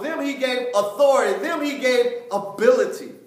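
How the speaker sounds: high, loud, emphatic preacher delivery; preaching. Real, not acted. Preacher driving scripture home to the class, full-force preaching.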